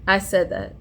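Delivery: ordinary talk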